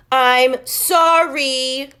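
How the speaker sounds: very loud, long drawn-out shout; yelled apology. A narrator's reading. Max yelling sorry at Chloe, loud drawn shout. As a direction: bellow the apology in her face. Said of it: "he yelled in her face".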